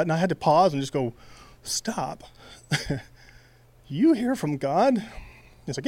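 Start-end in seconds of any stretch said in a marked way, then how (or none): 1.6-5.0 stunned disbelief. Retold or imitated. Stunned by friend's claim.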